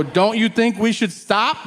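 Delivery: acted high-pitched exasperation; exasperated plea. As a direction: appeal to your dad in disbelief. Acted exasperated question to dad.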